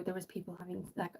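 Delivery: hushed, confiding undertone. Real, not acted. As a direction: drop the voice without meaning to. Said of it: "I don't know why I'm whispering".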